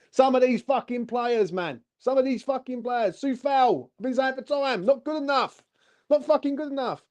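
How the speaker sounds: exasperated high-pitched rant; fed-up fan railing at the players; livid. Real, not acted. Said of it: "I'm fucking livid".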